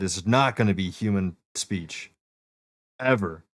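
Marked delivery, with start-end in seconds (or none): none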